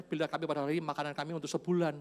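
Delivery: quick mock version of the prayer; mock misquote. Preacher mocks a greedy prayer, fast. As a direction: rattle off the fake prayer. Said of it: "Tuhan nggak ajar".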